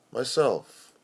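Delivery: plain reading voice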